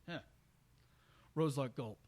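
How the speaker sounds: usual speaking voice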